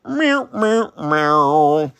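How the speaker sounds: high cat meows; meowing. Pretend. Artist meowing at the finished drawing, high and cute. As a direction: meow like a little cat.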